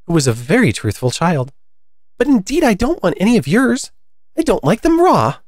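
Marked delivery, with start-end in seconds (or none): 2.2-5.4 put-on high-pitched storybook girl; high, emphatic character protest; Alice, protesting. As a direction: reassure the bird in a high child voice.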